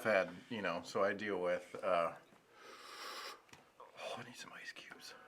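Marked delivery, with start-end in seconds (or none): 3.8-5.1 breathless whisper through the burn; pained whisper. Real, not acted. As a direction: whisper it, mouth on fire. Mouth burning from hot sauce.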